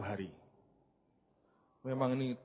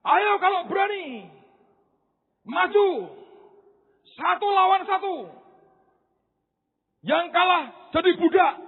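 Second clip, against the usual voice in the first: high, loud taunting giant; Goliath's challenge; 10 semitones higher, 6 dB louder than usual. Acted. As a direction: jeer at the Israelite army from across the valley. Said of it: "Goliatnya berkata". (tantangan raksasa yang mengejek, keras dan tinggi)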